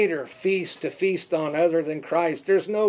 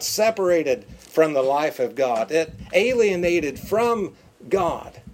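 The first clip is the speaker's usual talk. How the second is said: normal talking voice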